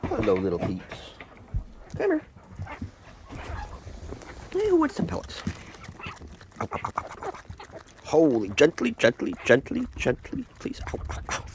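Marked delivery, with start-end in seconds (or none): 2.0-5.4 very high coaxing pet voice; pet talk. Real, not acted. Offering food to birds. 6.6-7.3 breathy whispered ow, ow, real small pain. Real, not acted. Bird pecking her hand. 10.9-11.5 breathy whispered ow, ow, real small pain. Real, not acted. Bird pinching her hand.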